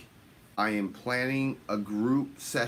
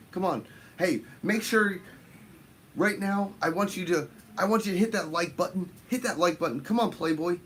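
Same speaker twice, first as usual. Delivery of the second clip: higher, fast urging to hit like; pushy hype plea; 5 semitones higher than usual. Acted. Streamer urging chat to hit like, hyped and higher. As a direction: egg the viewers on, hyped up. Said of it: "urging viewers to hit like".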